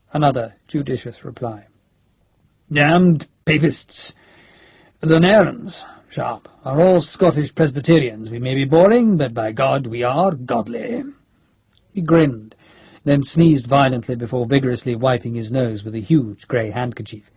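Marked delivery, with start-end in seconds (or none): 2.7-11.0 blustering senior officer, drawn-out emphatic words; Major General Nairn. Acted. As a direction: play a crusty old general proudly damning papists. Blustering Scottish general in story.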